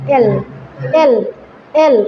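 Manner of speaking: এল with tongue held on the palate. Shown as a demonstration. Trainer drills teachers on L, deliberate এল. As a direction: isolate each "এল", tongue touching up on the l. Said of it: "জিবলাটা এই তালুর সাথে লাগবে".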